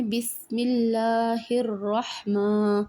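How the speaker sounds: very slow tajweed recitation, with الرحمن drawn out; slow recitation demo. Shown as a demonstration. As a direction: recite slowly, lengthening the long vowels. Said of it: "تو کیسے پڑھیں گے؟".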